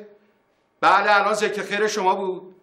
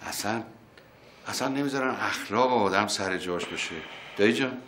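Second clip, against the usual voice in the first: much softer, lower, gentle; calm and soft; 7 semitones lower, 5 dB quieter than usual. Real, not acted. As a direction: soothe him after the outburst. Uncle calms down, softens to nephew.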